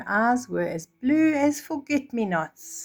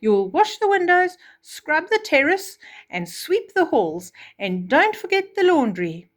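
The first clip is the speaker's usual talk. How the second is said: higher, louder barked orders; bossy stepmother; 8 semitones higher, 5 dB louder than usual. Acted. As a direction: rattle off chores to a servant. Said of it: "the stepmother said to Cinderella".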